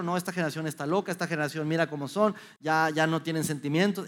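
quick, dismissive grumble at the youth; mocking critic. Speaker imitating adults who dismiss the young, quick and scornful. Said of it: "simplemente criticarlo".

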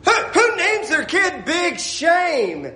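high, slow, stretched-out disbelief; incredulous outcry. Real, not acted. Preacher aghast at a child's name, incredulous outcry. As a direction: cry it out high, stretching every word.

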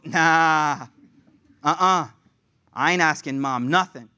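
lightly acted, emphatic no; loud, drawn-out nah, refusing outright; mock refusal. Favorite sibling refusing to ask angry Mama, emphatic.

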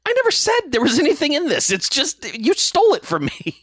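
put-on high, rushed kid's self-defence; acted indignant protest. Podcaster plays the kid answering angry gangsters, indignant.

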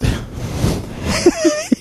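sudden high laugh; high burst of laughter. Real, not acted. Laughing at the topic.

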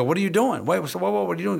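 high-pitched, urgent retold interruption; alarmed disbelief. Trainer stopping a handler mid-exercise, incredulous. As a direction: cut in with a high, baffled challenge.

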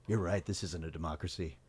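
low, soft, subdued agreement; imitating a man quietly backing down; mock concession. Bill's acted reply before rallying.

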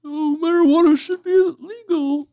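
mock the candidate in a strained high voice; Gary Johnson impression. Asked for his impression, he voices the candidate in a high put-on voice. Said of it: "Let's hear your Gary Johnson impression".

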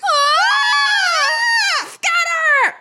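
high, loud scream of alarm; panicked shout. Acted. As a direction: yell to scatter in panic. Mouse spotted, panic.

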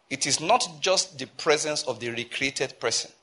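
ordinary talk

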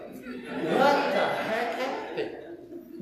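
high, very drawn-out astonishment; imitated startled bystander. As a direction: stretch it out in mock shock.

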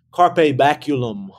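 ordinary talk, nothing marked

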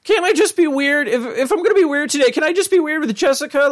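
put-on high, whiny nervous teen voice; Morty impression. Retelling the episode, imitating Morty pleading to Rick in a high whine. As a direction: pitch way up and whine like a jittery kid.

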